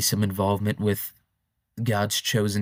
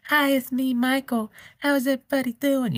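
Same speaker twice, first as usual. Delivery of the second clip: mock public-appearance voice, far higher. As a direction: go way up, gentle and childlike. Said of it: "You know, he talked like that".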